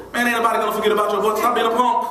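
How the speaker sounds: friend's playful reply. Retold or imitated. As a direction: rattle it off high like a cocky buddy. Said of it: "send me letters back".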